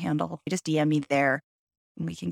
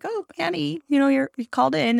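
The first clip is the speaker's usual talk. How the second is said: much higher, louder sing-song greeting; cheery lab lady; 9 semitones higher, 4 dB louder than usual. Retold or imitated. Lab tech greeting Annie at the chair, high chirpy cheer. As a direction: imitate the lab lady's chirpy welcome. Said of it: "the lady's all cheery".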